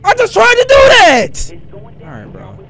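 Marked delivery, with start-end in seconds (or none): 0.0-1.5 high, loud, drawn-out outburst; frustrated yell. Real, not acted. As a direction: shout it, fed up. Frustrated at game.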